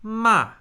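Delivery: Ma starts very high and falls at once; fourth tone. Shown as a demonstration. Teacher shows the fourth tone falling from first-tone height. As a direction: drop Ma the moment it starts. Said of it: "Does that make sense?".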